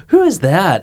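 gasp, then high-pitched cooing at a dog; pet-talk voice. Retold or imitated. Retelling how they greet dogs on the street, pet-talk voice. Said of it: "a voice like that you talk to pets in".

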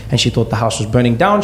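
ordinary talk, nothing marked

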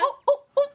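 high, loud run of oohs; imitated "ooh" reaction. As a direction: hoot a string of high oohs, mock-scandalised. Retelling own scandalised reaction to scene.

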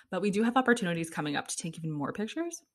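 usual speaking voice

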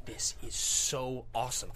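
slow, drawn-out awe; awestruck. Acted. Viewer gripped by a great movie moment, slow awe. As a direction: savour each word in amazement. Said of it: "and you're just like".

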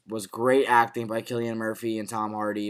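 ordinary talk, nothing marked

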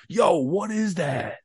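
high, drawn-out mock call; imitated dudes calling. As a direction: imitate a curious guy calling from the next car. Imitates two dudes calling over.

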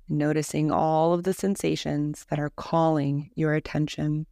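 calm, measured Yoga Nidra guidance with long pauses; guided meditation. Acted. Teacher leading a listener through a Yoga Nidra body scan, guided meditation voice. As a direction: lead them gently into rest, leaving space between phrases.

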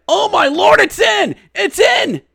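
shouted high goal call; thrilled play-by-play shout. Real, not acted. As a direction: yell it, it's in.

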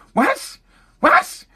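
put-on disbelieving parent staring at his kid; mock shocked squeal at the kid; dumbfounded parent. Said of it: "when your son does something".

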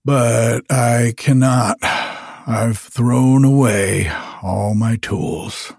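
slow, heavy despairing glass blower; slow refusal. Acted. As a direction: let it sink, slowly.

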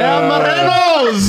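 slow, booming game-show intro; announcer shout. Acted. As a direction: call the team name like an announcer. Host announcing team name.

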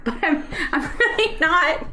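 laughing while protesting. Real, not acted. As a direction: giggle through the denial. Embarrassed about defending Kylie.

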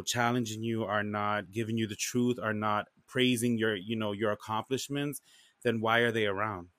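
ordinary delivery

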